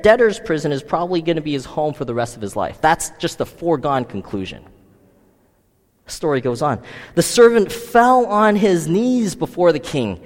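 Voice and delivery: usual speaking voice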